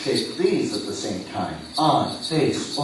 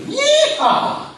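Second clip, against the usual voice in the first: much higher whooping yeehaw; quick cowboy whoop; cowboy yell; 7 dB louder than usual. Retold or imitated. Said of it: "you jump up and say".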